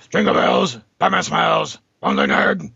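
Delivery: hoarse, growled Christmas carol parody. Pretend. Joking about a superhero Christmas album, rasping mock growl. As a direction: growl out a carol like a gruff masked vigilante.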